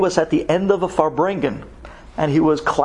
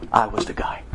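I was the guy, whispered low; hushed reveal; 6 semitones lower, 3 dB quieter than usual. Real, not acted. Rabbi whispers his secret to the stunned crowd. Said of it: "Do you know how I know this story?".

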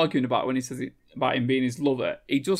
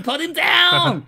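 high, loud, drawn-out holler; calling out; 12 semitones higher, 7 dB louder, slower than usual. Retold or imitated. Bystander begging Arnold to release the man, stretched mock shout. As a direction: shout it slowly as an acted plea.

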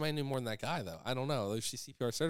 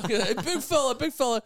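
high, loud mock heckle through a laugh; mocking taunt; 11 semitones higher, 8 dB louder than usual. Street hecklers fat shaming him, loud mocking jeer. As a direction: imitate someone jeering BIG FELLA. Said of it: "fat shaming me, calling me".

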